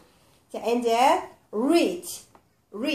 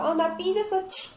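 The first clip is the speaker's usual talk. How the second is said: higher-pitched pretend huff ending in a short "치"; mock sulking; 7 semitones higher than usual. Teacher acting miffed to teach the ch sound, mock sulk. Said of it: "소리가 나는 거였어".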